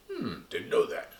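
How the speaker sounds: high breathy puppet murmur; mock surprise, hushed; puppet aside. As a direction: puppet hears it is a puppet. Puppet learns it is a puppet.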